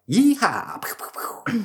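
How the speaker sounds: high whooping cowboy yell, hammed up; Wild West cowboy. Storyteller playing robbers shooting around, mock cowboy whoop. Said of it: "you know, Wild Wild West".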